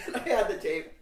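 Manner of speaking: loud, high words through laughter; real laughing. Real, not acted. Teller finally getting the secret tape, laughing. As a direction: blurt it out while cracking up.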